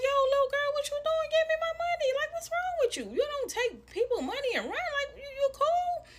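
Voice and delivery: high, loud, indignant re-enacted telling-off; retold scolding. Woman confronting the girl who took her money, indignant.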